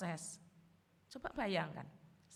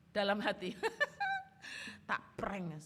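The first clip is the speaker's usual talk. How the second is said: usual speaking voice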